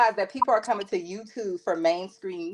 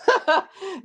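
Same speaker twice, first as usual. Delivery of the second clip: sudden high laugh; high burst of laughter; 13 semitones higher than usual. Real, not acted. Burst of laughter mid-sentence.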